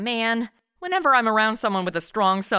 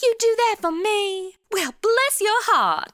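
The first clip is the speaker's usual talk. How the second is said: high, slow, syrupy southern belle voice; southern accent; 12 semitones higher, slower than usual. Acted. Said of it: "I'm starting to sound like a Petticoat Junction marathon".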